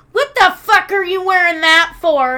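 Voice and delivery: mock outrage, punched loud; retold indignant outburst. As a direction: imitate a dad's appalled outburst. Retold dad confronts her over shirt.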